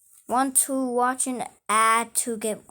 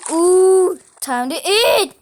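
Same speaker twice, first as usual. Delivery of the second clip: excited; 6 semitones higher, 8 dB louder, slower than usual. Real, not acted. Gleeful game moment.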